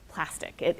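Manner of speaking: ordinary talk, nothing marked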